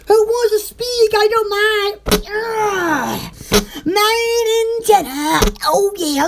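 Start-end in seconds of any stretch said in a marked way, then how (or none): none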